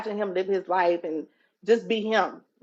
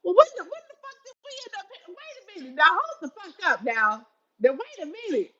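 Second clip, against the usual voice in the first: laughing disbelief; 11 semitones higher than usual. Retold or imitated. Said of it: "You like".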